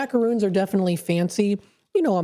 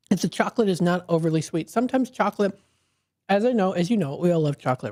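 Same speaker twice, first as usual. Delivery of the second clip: ordinary talk, nothing marked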